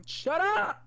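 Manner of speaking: high, loud burst of shout; sudden shout. Real, not acted. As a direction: snap out of the voice yelling. Breaks character, snapping at friend.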